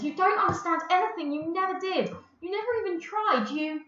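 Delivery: high, loud, threatening rant; almost threatening. Acted. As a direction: bear down on her with each never. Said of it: "almost threatening her".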